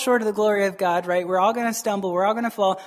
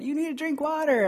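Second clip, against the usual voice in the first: higher-pitched mock nagging; nagging wife; 6 semitones higher, 4 dB quieter than usual. Wife getting on husband about drinking water, nagging. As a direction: lightly imitate a wife's reminder, pitched up.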